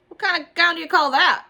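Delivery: put-on high, loud jeering boy; mean taunt. A narrator's reading. Meanie Jim mocking Junie's gown, high and loud. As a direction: sneer at her gown. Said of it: "said Meanie Jim".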